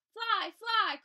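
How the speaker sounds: high loud call to fly. A narrator's reading. Birds calling to the bees to flee, high loud call. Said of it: "called the birds to the bees".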